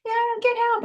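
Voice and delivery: high, jokey Get help, goofing off; a teenager mocking a cry for help; imitating teens fooling around; joking teen call. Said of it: "just goofing off playing".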